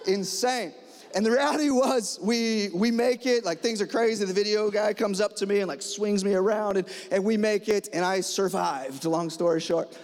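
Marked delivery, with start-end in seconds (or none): none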